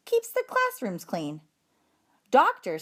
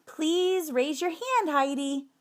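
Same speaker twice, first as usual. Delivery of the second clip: higher, slow, patient teacher voice; teacher correcting; 8 semitones higher, slower than usual. Acted. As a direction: gently remind a pupil of the rules, slowly. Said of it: "Missus Brisbane said".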